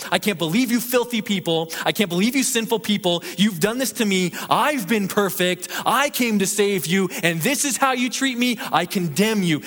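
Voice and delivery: high, indignant preacher's outburst; acted condemnation. Preacher imagining Jesus condemning sinful people, indignant. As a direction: act out wounded outrage, punching each accusation. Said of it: "uses his tongue to condemn".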